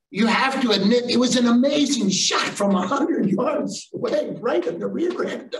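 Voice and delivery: put-on high, gleeful kid voice; high-pitched, laughing at the end; excited six-year-old. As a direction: imitate a boy bursting with admiration. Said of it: "then the littlest guy".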